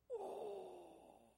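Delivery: long breathy "oh"; acted-out moan of dismay; stricken groan. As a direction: groan as if struck, retelling it. Struck by the word in vision.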